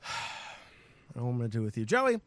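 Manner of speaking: ordinary delivery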